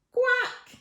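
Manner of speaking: high-pitched duck quack. Acted. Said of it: "said the duck".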